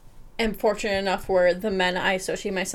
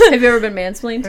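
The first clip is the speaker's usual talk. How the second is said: fast and louder, laughing through the words; laughing question; 2 semitones higher, 7 dB louder, faster than usual. Real, not acted. Host turning to the guest while laughing. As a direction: ask while still giggling.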